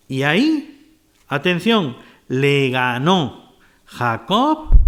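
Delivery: ordinary talk, nothing marked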